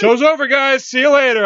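loud, high-pitched mock exit; giddy comic outburst; excited sign-off. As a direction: shout that you're abandoning the show. Jokingly quits show over cartoon news.

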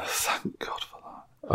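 whispered under the breath, slowly; a slow, relieved whisper to yourself; whispered aside. Real, not acted. Relieved aside at final question.

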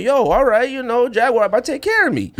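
high-pitched, hopeful retelling; excited anticipation. Retold or imitated. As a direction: act out getting your hopes up. Acting out his hopeful reaction.

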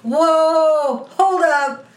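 loud, high-pitched mock alarm; shocked protest. Reacting to the dark turn, shocked protest. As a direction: call a sudden halt, voice jumping up.

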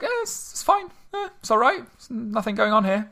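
high, breezy, unbothered; casual shrug-off voice. Retold or imitated. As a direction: brush it off like it's nothing. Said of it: "it's like".

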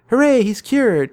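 high, loud, slow hooray; cheering. Acted. Tintin cheering that the captain is cured, high and loud. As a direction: cheer him back to health.

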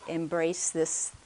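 ordinary talk

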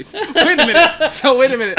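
high, loud laughing disbelief. Real, not acted. Bursting out laughing at answer.

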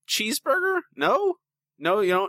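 high, disbelieving questions; can't believe what he's hearing; incredulous. Real, not acted. Incredulous at friend skipping beef.

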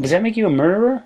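ordinary delivery

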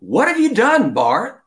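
high, loud alarmed cry; screaming. Acted. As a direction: shout it at the traitor in shock. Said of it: "Peter screamed out".

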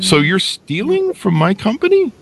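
high, shocked retold question; shocked accusation. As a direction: jump way up in outraged disbelief. CEO confronts the stealing employee.

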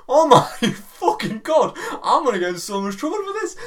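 high, loud laughing dismay; laughing panic. Retold or imitated. Recalling hitting a girl, laughing in panic. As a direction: laugh through mock horror.

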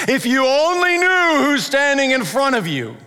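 a mighty wizard's booming proclamation with a laugh at the end; booming like Gandalf. Pretend. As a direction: rise up in power and boom it out. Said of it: "boomed like Gandalf in Lord of the Rings".

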